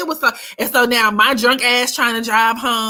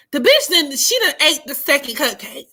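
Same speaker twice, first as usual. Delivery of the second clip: ordinary talk, nothing marked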